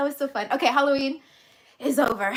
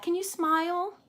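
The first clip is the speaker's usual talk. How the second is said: higher, softer coaxing voice; a touch of pet talk; coaxing the dog; 5 semitones higher, 3 dB quieter than usual. Real, not acted. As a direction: sweetly ask the pet to smile.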